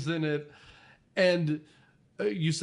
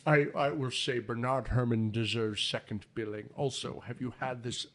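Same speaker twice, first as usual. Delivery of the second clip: low, choked-up mouthful voice; Hitchcock; 3 semitones lower than usual. Acted. Host mimicking the director for his co-hosts, choked impression. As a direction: talk as if choking on a burger. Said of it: "say it like you're choking on a, a McDouble".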